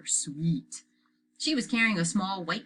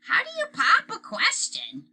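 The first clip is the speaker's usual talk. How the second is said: put-on 1 little boy voice; 5 dB louder than usual. A narrator's reading. Fudge voiced high.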